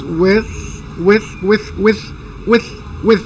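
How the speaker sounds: "with" softly, tongue behind the teeth; soft TH at the end of "with"; TH demo. Shown as a demonstration. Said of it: "la pueden poner atrásito de los dientes". ("with" con la lengua tras los dientes)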